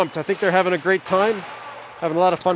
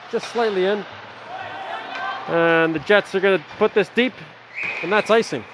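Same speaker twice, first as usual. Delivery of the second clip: steady live game call; hockey play-by-play; 4 dB quieter than usual. Acted. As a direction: call the action for the stream. Calm play-by-play after break.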